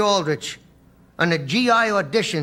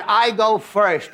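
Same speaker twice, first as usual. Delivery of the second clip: farmer announcing; 3 dB louder, much slower than usual. Acted. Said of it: "The farmer says".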